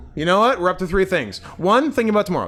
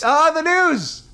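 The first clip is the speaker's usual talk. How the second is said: excited; 11 semitones higher, 4 dB louder, slower than usual. Pretend. Mock delight at the news.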